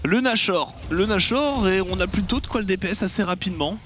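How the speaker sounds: rising excited call, quick; esports caster hype. Real, not acted. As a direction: build the hype on Nashor. Caster hypes the Baron objective. (commentateur qui s'emballe)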